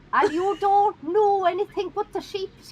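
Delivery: rural Irish accent. Shown as a demonstration. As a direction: lay on a thick Irish country accent, drawn out. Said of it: "you sound like this".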